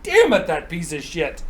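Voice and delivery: mad. Acted. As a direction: play a thwarted giant cursing.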